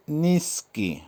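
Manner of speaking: নিস্কি split as নিস-কি; teacher modelling নিস্কি clearly; careful pronunciation demo. Shown as a demonstration. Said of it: "উচ্চারণ হবে". (নিস্কি-র স্পষ্ট উচ্চারণ)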